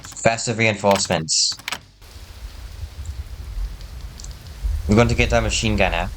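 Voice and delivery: put-on a mild try at a German accent. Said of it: "I'm trying to do a German accent".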